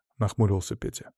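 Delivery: plain reading voice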